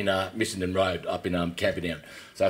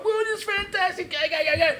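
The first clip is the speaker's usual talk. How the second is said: much higher, thrilled shouting it along; gleeful whoop. Retold or imitated. Drunk man rolling down the hill, gleeful high cheer. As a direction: cheer yourself on flying downhill. Racing downhill in stolen wheelchair.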